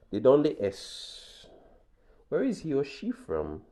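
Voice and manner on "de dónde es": ¿De dónde es? drawn out slowly, stress on dónde; slow model question. Shown as a demonstration. Teacher introducing the third-person question, slow.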